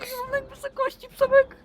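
high panicky cry about the height; scared. Acted. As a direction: fear of heights, voice cracking up high. Afraid on the roof. (przestraszona, piskliwy głos)

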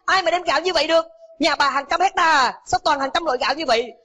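furious yell. Acted. Cook driving away the poor mother, furious yell. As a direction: throw her out like a furious cook. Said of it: "hét lên".

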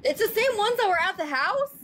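high, incredulous echo of what was just said; startled, high-pitched double-check; surprised question. Real, not acted. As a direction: repeat it back in disbelief, pitch jumping up. Astonished echo question about officers.

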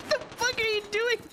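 high, incredulous cry; shocked disbelief. Real, not acted. Shocked at teammate's move.

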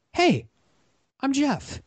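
very high, sing-out hello; chirpy retold greeting. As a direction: introduce yourself in a high-pitched, perky voice. Retold greeting to a flustered stranger.

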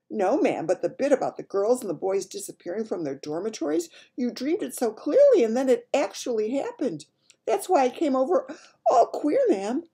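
high, loud maid getting worked up; agitated maid. Acted. Maid explaining her fright to the Queen, agitated. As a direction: build to an overwrought peak.

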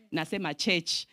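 ordinary delivery

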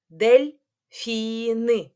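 Дельфіни stretched slowly into дель-фі-ні; very slow syllable demo. Shown as a demonstration. Teacher counts syllables by clapping with children. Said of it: "порахуємо руками".

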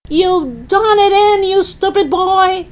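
put-on high-pitched old-woman holler; scolding shout. Grandma blames George for the sick hen, shouting. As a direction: scold the boy from the rooftop, very high. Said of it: "Grandma shouted".

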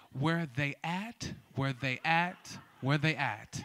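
slow, low "Where they at"; careful standard pronunciation. Shown as a demonstration. Host teaches the crowd the response, enunciating "they" slowly. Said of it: "we can speak it generally".

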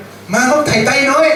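sing-song jab at the little one; mocking call. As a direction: another sibling calling him mockingly. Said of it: "อีกคนหนึ่งก็เรียก".

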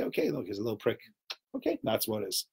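low, soft, matter-of-fact reassurance; quiet, unfussed self-soothing; calm acceptance. Retold or imitated. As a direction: play it down softly. Acts out calm acceptance of needle.

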